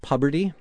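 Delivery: "Puberty" with a short u, as PUB-er-tee; joke mispronunciation. Shown as a demonstration. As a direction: say "Puberty" to start like the word "pub". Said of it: "I believe it's pronounced".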